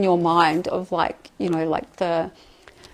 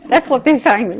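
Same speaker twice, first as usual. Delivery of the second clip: laughing it off, higher and louder; 5 semitones higher, 8 dB louder than usual. Real, not acted. Channeler laughing at the blunt message. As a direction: giggle through the apology.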